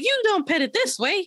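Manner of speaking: high, bossy big-sister imitation; mock-correct in a high pitched know-it-all voice; Emmy. Co-host quotes the sister correcting how to pet the cat, high mock bossiness. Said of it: "then she's like".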